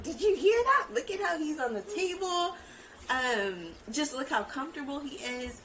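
high, loud thrilled gasp of disbelief; shocked excitement. Retold or imitated. Mom retelling the room's shock at her son, surprised and high. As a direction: say it like the whole room just heard a miracle. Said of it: "It was like".

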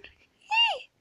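a sudden high, squealed cheer; high-pitched happy squeal; excited yay. Real, not acted. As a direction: squeal it with real delight. Cheering a Rogue Squadron announcement.

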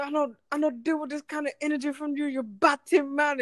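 put-on cook turns West Indian accent on the customer. Cook scolding customer in West Indian accent. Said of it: "a West Indian accent".

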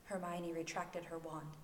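usual speaking voice

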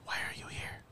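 breathy ghostly whisper from the recording. Retold or imitated. Retelling the ghost voice on the phone recording, whispered. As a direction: hush it like a voice caught on tape. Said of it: "in the background you hear going".

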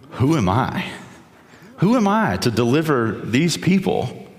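high anxious objection to God; Moses protesting. Acted. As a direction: protest like a man who can't believe he's chosen. Moses doubting God's call.

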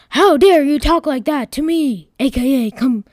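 loud, indignant telling-off; angry. Acted. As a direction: shout it in outrage at the computer. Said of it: "I said angrily toward the computer".

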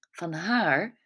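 ordinary delivery

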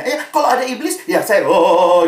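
acting out a teen's loud show against the devil; eager teen demonstrating. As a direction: play the youngster, loud on the "oh". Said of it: "gitu ya, saudara ya".

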